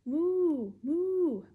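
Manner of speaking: high mooing; cow moo. Acted. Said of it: "He can go like a cow".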